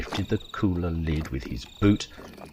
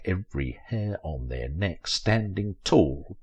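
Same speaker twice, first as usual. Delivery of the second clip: ordinary delivery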